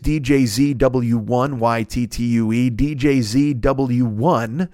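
slow, letter by letter code; spelling slowly. Shown as a demonstration. Host reading Uber code to listeners, slowly spelled. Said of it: "Now, this is all lowercase".

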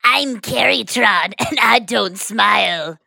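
slow, drawn-out villain robot; Decepticon voice. Acted. Carrie introducing herself as a robot villain, Decepticon voice. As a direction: play a menacing Decepticon, stretching each word. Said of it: "that was your Decepticon voice?".

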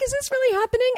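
very high, giddy disbelief; retold excitement. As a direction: squeak it out like you can't believe it. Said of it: "I was so excited".

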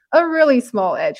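"small" given extra weight; stress on small. Real, not acted. Co-host hedging a finals prediction, stress on "small". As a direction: lean on "small" in "really small edge". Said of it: "Emphasis on small".

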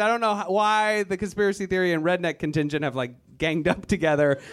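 ordinary talk, nothing marked

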